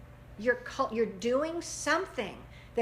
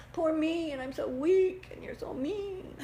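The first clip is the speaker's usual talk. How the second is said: poor me whine; 5 semitones higher than usual. Acted. Victim whine acted out.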